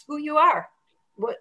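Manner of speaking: ordinary talk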